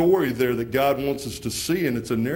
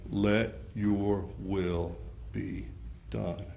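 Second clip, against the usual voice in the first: dying whisper of trust; 5 semitones lower, 9 dB quieter than usual. Acted. Jesus on the cross giving up his spirit, barely audible. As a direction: let the last words slip away.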